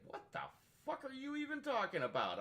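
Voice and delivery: mock shock. Friend [name] reacting to his racism take, high-pitched mock disbelief. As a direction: squeak it out in mock outrage.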